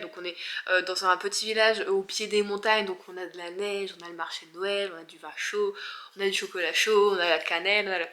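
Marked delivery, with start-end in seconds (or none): none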